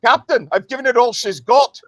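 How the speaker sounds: a lightly done starship engineer's cry. Shown as a demonstration. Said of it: "That's Star Trek's".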